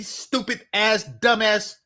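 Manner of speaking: ordinary delivery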